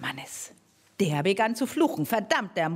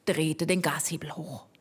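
plain reading voice

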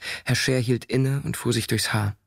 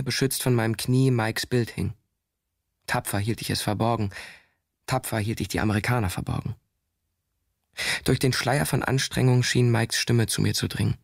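ordinary delivery

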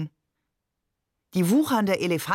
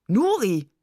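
astonished call of a name; 2 semitones higher, 4 dB louder than usual. A narrator's reading. Father to Nuri after the coin trick, calling out. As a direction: call the name out, mock-amazed. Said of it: "rief er".

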